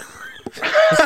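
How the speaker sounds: a burst of high-pitched laughter. Real, not acted. Cop laughing at a colleague's answer, high laugh.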